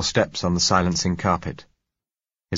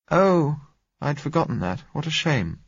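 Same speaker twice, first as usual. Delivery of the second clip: disappointed exclamation; 10 semitones higher than usual. A narrator's reading. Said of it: "she exclaimed disappointedly".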